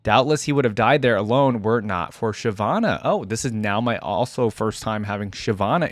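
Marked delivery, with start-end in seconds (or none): none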